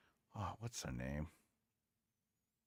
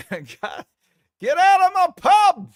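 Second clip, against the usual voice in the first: much higher and louder, slow, in a put-on London voice; mock pub landlord shout; 14 dB louder, much slower than usual. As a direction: bellow like a landlord throwing someone out. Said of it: "shout out".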